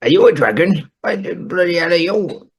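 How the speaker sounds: high, indignant surprise; a grumpy old man caught off guard; startled grump. Acted. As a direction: recoil at the sight of a dragon. Startled at dragon.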